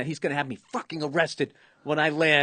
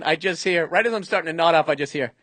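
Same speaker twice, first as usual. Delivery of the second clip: ordinary delivery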